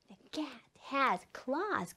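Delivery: slow, hushed repeat; quiet slow model sentence. Shown as a demonstration. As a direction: say it softly, word by word.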